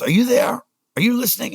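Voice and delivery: put-on high, loud Afrikaner-accented demand; furious boss checking you're listening, high mock yell. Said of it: "he's screaming at me".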